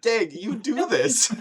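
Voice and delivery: high, loud, slow protest; exasperated mock yell. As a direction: drag it out in a loud, high-pitched complaint. Acts out his exasperated reaction.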